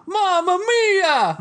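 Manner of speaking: high, slow, long "Mamma mia!"; Italian cry. Retold or imitated. Italian cop screaming inside the building, high drawn-out "Mamma mia!". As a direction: wail it like a panicked Italian cop. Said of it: "you hear a".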